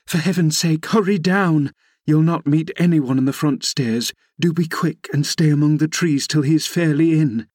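high-pitched, anxious urging; urgent exclamation. A narrator's reading. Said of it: "I exclaimed".

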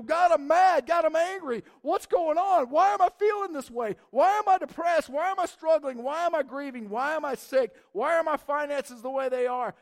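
pouring out anger in a loud, pleading prayer; angry prayer. Retold or imitated. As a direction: yell your frustration straight at God. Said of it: "if we go".

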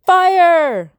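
high, loud scream of alarm. Acted. Greg points at Tumble and screams a warning. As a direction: shriek the single word out in panic. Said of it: "and screamed".

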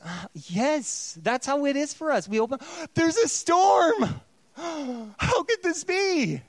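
high, gasping mock astonishment, slowing on the last line; hammed-up shock. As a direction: clutch your pearls over a rainstorm.

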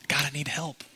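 breathy hushed plea to God; hushed pleading whisper; whispered prayer. Acted.

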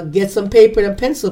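ordinary delivery

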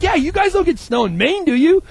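clueless dorm guy. Retold or imitated. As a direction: ask it in pure innocence. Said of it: "He's like".

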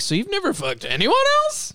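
high put-on seductive voice; mock flirty staffer. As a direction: ham up the come-on, pitched high. Mocking the staffer's pickup line.

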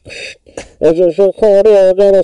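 loud high shouting into a cup; screaming into a glass. Pretend. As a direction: yell it into the glass like a mic. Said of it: "you're screaming into that glass".

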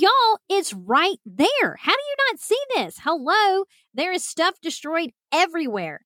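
high, exasperated mock insistence; retold freak-out. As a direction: insist they see it, pitch leaping up. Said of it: "in the midst of my freak out".

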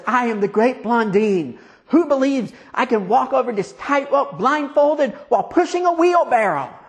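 showman whipping up crowd. Acted. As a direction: work the crowd up, pitched high. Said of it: "And he says".

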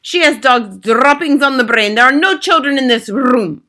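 high, loud scornful shout; Grand High Witch. A narrator's reading. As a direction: dismiss it loudly as the witches' leader. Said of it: "shouted the Grand High Witch".

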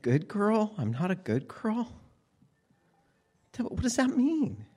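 lightly voiced child, high and puzzled; bewildered child. A five-year-old puzzling over the aunt's words, confused child voice. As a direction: ask it like a small kid who doesn't get it.

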